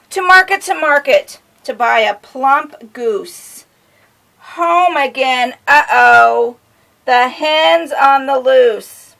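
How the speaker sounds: sing-song picture-book reading; reading to children. A narrator's reading. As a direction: lilt it for a little listener.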